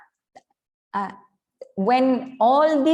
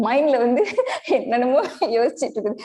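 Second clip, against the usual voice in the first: rapid words through laughter; laughing; much faster than usual. Real, not acted. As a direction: chuckle through a fast line. Laughing about a restless mind.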